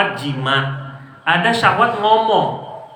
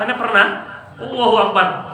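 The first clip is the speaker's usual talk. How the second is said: ordinary talk, nothing marked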